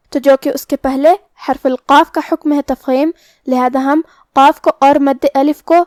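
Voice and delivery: normal talking voice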